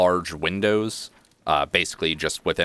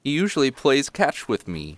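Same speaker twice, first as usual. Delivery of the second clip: put-on high little-boy voice; youngest child; 7 semitones higher than usual. Little boy missing his game of catch, high child voice. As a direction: pitch it up like a small kid. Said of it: "the youngest one".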